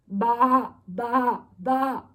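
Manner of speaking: loud baas; sheep bleat. Acted. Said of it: "said the fat red hen".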